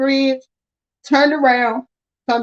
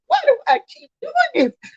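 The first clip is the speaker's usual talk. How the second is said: high frustrated wail; 14 semitones higher than usual. Retold or imitated. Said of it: "I was like".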